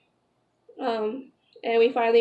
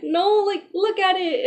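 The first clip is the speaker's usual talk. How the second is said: retold plea, pitched up high; insistent pleading; 7 semitones higher than usual. Woman urging doubting fiance to check the test, insistent pleading. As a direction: urge him, high and pressing.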